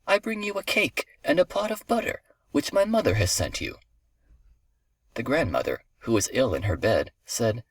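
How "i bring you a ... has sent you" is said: high-pitched fake little-girl voice; imitating girl's voice. A narrator's reading. Wolf imitates the girl to get inside, high girlish voice. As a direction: pose as a sweet child, pitched up. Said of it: "imitating the girl's voice".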